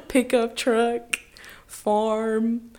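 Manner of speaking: high, loud laughing repeat; laughing through a mock drawl; laughing echo. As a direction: giggle the words out. Echoing in country voice, laughing.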